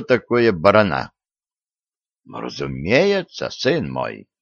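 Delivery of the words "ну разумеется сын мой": put-on low, unhurried old churchman; old priest voice. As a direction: speak low like an aged patriarch. Elderly patriarch answering, low voice.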